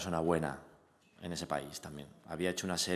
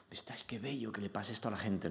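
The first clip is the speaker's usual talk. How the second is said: a tearful fan's soft whisper; retold whisper; 6 dB quieter than usual. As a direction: whisper "Viste, ay, qué bello" softly. Audience member quoted, moved.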